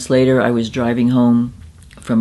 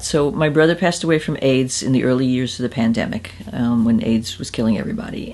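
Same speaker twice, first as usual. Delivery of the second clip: usual speaking voice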